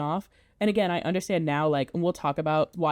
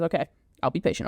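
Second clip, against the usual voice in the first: quick, impatient mock grumble; grudging agreement; 3 semitones lower, faster than usual. Todd brushing off Mrs Lovett, fast grudging okay. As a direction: rattle it off to get her off his back. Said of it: "he goes".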